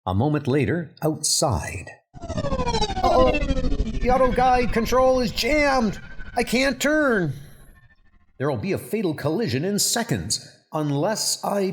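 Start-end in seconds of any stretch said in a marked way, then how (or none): 2.2-7.3 much higher, alarmed female voice; panicked woman driver. Acted. Driver cries out about her jammed car, high and panicked. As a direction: squeal it out as a frightened driver losing control.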